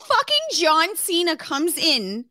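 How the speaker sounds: high, loud giddy repeat; excited squeal. Real, not acted. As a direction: blurt it with delighted disbelief. Excited retelling of movie.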